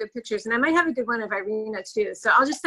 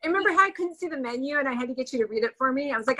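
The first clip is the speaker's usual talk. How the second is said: normal talking voice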